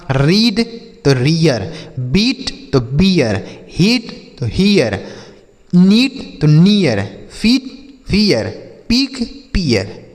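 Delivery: slow drilled word pairs, रीड then रियर; deliberate teacher demo, "ee" opening into "ee-yer" in हियर; contrasting vowel sounds. Shown as a demonstration. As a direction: stretch each word, long vowel in बीट, gliding "ee-yer" in बियर. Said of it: "उच्चारण ई ए हो जाता है".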